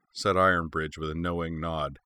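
usual speaking voice

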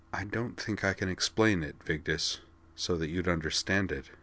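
softly. Acted. Corwin, holding her shoulders, speaking softly. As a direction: say it softly, sorry. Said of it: "and said softly".

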